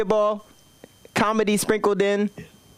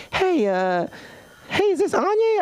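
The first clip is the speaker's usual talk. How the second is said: high, chirpy caller voice; Cheryl's voice; 13 semitones higher than usual. Retold or imitated. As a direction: put on a bright clerk voice. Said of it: "She's like".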